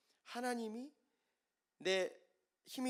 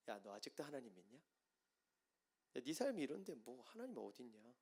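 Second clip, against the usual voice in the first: mocking; 2 semitones lower, 9 dB quieter than usual. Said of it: "이렇게 조롱해요".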